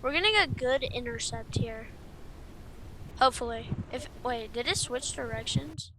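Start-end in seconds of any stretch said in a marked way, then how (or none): none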